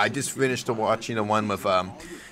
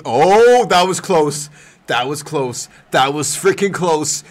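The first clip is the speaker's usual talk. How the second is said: louder, panicked near-miss; relieved startled outburst; 5 semitones higher, 9 dB louder, slower than usual. Real, not acted. Streamer catching himself before a slur, loud startled relief. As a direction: blurt it out after almost slipping.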